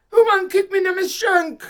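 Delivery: loud, high complaining yell; outraged shout. Retold or imitated. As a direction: shout it half laughing at the memory. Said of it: "And me hear".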